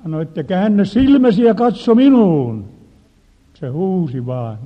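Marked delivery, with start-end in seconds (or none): 0.4-2.6 commanding shout. Retold or imitated. Casting out a demon.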